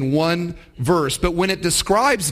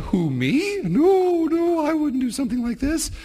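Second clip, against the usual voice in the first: mock innocent Satan; 8 semitones higher than usual. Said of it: "And Satan going".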